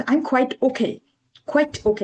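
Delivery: "quite" as one quick syllable, kwite; quite pronunciation. Shown as a demonstration. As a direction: keep "quite" short, no break in the vowel. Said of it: "ওইটার pronunciation".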